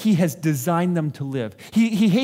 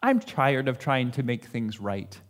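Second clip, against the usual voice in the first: weary resignation; 6 semitones lower, 5 dB quieter than usual. Retold or imitated. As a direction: sigh it away, worn out. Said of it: "my response is".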